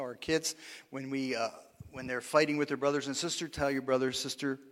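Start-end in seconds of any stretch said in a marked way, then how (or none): none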